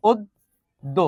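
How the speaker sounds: oddo, closed first vowel in "অদ্য"; correct closed vowel. Shown as a demonstration. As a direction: say "অদ্য" softly with a round o. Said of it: "এটা হবে এরকম".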